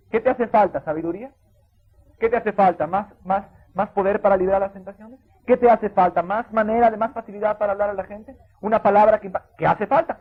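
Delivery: loud, high, repeated pulpit question; rousing preaching. Acted. Pastor pressing listeners on their needs, rousing preaching. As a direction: drive the question home each time.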